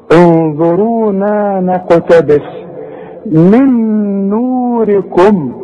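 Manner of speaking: tajweed recitation with two-count madd; slow recitation. Shown as a demonstration. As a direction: recite slowly, holding each long vowel. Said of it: "شوف المد". (تلاوة بطيئة بمد حركتين)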